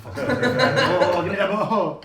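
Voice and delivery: laughing. Pretend. Voicing grabbing player.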